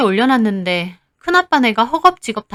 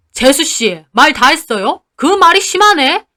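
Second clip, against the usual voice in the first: louder, rising indignation; angry uncle; 5 semitones higher, 5 dB louder than usual. Retold or imitated. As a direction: warn her she went too far. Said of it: "큰아빠도 덩달아 따지고요".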